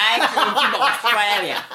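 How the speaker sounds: high-pitched mock Australian, laughing; hammed-up Australian accent introduction; bad Australian accent. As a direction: mimic your co-host in a broad bad Australian accent. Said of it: "It went from bad Australian".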